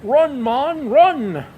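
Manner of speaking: loud Scottish cheer from the stands; Sean Connery yell. Retold or imitated. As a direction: yell at the runner like a thrilled Scotsman at the ballpark. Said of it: "Sean jumps up and is yelling".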